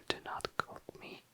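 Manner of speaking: ordinary talk, nothing marked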